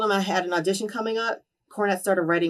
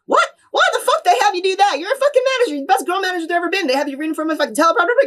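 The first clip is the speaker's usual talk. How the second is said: much higher, louder furious rant; retold tantrum; 11 semitones higher, 6 dB louder, faster than usual. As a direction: go off like an outraged mentor. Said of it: "And he went off".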